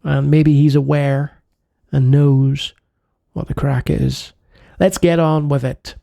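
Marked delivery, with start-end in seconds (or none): none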